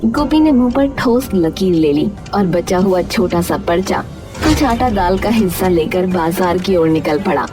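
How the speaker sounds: ordinary delivery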